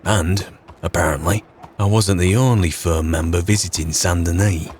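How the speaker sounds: steady storytelling narrator voice; story narration. A narrator's reading. Yorkshire lad narrating his rise to Saint-Denis, storytelling voice.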